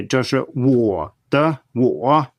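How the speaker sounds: "我" stressed hard with the full falling rising tone; heavily stressed syllable. Shown as a demonstration. 强调"我"字的全上声. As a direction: lean hard on the second "我", slow. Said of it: "when people stress a syllable a lot".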